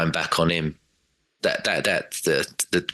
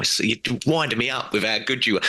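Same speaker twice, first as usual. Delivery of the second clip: higher, half-joking fan rant at a rival player; hammed-up frustrated groan, pitched up; mock exasperation; 5 semitones higher than usual. Fan voicing exasperation at Gundogan.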